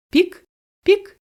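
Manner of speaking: very high, tiny peep; chick's peep. A narrator's reading. Hatched chick peeping to its family, very high.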